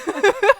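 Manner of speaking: sudden high loud laugh. Real, not acted. Laughs after cursing joke.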